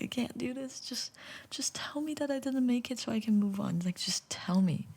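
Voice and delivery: high, hushed and desperate; anxious whisper. Retold or imitated. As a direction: plead under your breath, dreading the news. Anxious waiting for the call.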